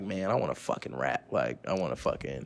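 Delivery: low, soft inner voice; quiet inner voice. Retold or imitated. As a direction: murmur it to yourself.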